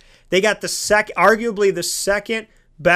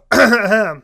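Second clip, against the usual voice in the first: clearing throat; 4 semitones higher, 5 dB louder than usual. Real, not acted. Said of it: "Pardon me".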